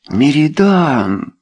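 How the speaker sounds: high, drawn-out cry of the name; astonished exclamation. A narrator's reading. Wife recognising the count's name, exclaiming. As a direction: exclaim in disbelief. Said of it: "воскликнула леди Белвиль".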